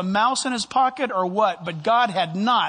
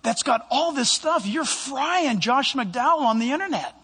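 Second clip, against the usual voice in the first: higher, urgent caller breaking bad news; higher, urgent worried caller; worried board member; 6 semitones higher than usual. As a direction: voice the anxious friend on the phone, pitched up. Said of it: "And he said".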